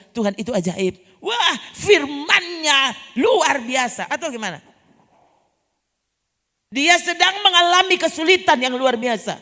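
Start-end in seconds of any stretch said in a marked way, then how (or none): 1.2-4.1 loud, slow, higher, ecstatic cry of praise; joyful shout; exultant praise. Pretend. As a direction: shout it out like a revival believer. Preacher mimics praising believer.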